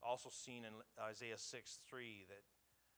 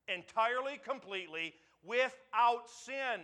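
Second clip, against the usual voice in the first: much higher and louder, pounding each phrase; full-force preaching; 8 semitones higher, 11 dB louder than usual. Acted. Pastor thundering to the congregation about God's holiness, full-force preaching. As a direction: build the sermon to a ringing climax. Sermon climax.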